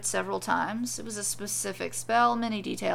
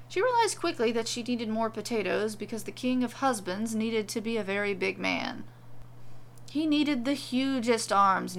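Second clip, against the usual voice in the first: ordinary delivery, nothing marked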